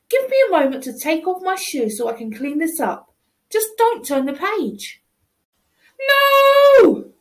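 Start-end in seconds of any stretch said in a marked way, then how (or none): 6.0-7.1 high loud wail of no; loud high protesting no; dismayed shout. Acted. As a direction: cry out in dismay. Child dismayed at page turn.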